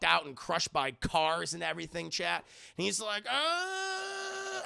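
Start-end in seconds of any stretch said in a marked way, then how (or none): 3.3-4.6 long, very high held cry; drawn-out mock wail of effort; imitated straining scream. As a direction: scream as if holding on for dear life. Peter straining to hold the ship.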